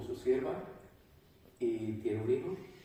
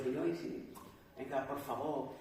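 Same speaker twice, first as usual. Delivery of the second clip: soft pleading; 8 dB quieter than usual. Acted. Pleading prayer to God acted.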